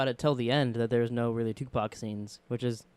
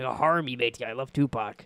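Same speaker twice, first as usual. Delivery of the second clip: pirate voice; 2 semitones lower than usual. Retold or imitated. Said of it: "the old pirate voice".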